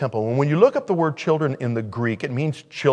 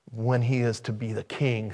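ordinary talk

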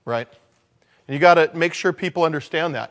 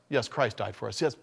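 normal talking voice

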